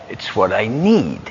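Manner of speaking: ordinary talk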